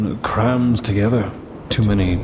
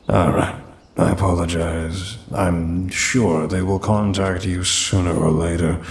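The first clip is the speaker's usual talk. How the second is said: ordinary talk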